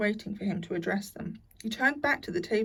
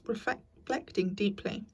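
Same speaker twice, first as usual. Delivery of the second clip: usual speaking voice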